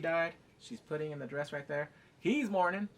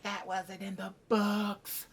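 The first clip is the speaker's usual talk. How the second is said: higher, drawn-out mock whine; mocking complainer; 4 semitones higher, much slower than usual. Imitating fussy book fans, mocking and drawn out. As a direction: drag out the complaint in a put-on voice.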